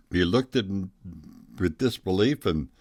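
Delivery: usual speaking voice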